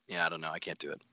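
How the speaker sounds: normal talking voice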